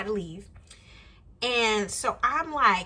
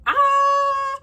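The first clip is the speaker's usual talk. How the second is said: startled yelp; 8 dB louder than usual. Retold or imitated. Startled as man chases her.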